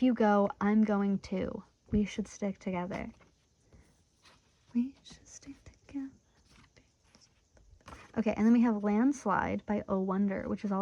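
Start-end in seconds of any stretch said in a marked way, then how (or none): 4.7-6.1 soft, higher whispered repeat. Shown as a demonstration. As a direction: breathe the line back as a secret. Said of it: "the same line repeated as a whisper".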